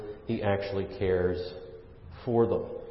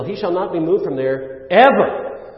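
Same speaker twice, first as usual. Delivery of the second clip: loud, higher line landing hard on "ever"; stress on ever; 7 semitones higher, 9 dB louder than usual. Shown as a demonstration. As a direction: drive through to a punched "ever". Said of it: "with the emphasis on the last Hebrew word".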